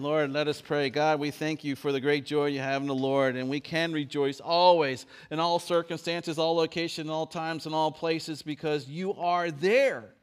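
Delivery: loud, strong prayer voice; fervent prayer. Acted. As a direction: pray it out boldly. Prayer at full force.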